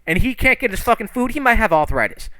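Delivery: high-pitched outraged telling-off; acted righteous indignation; indignant scolding. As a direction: berate him on someone else's behalf, voice climbing. Character scolds on old man's behalf.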